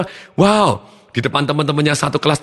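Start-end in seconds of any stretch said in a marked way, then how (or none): 0.3-0.9 impressed wow. Retold or imitated. Reacting to student's speech.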